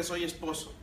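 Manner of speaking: normal talking voice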